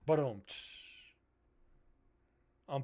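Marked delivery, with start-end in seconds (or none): 0.1-0.9 imitated drum rimshot with sizzling cymbal; ba-dum-tss drum sting; mouthed rimshot. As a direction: do a vocal rimshot after a bad joke. Imitates rimshot after own joke.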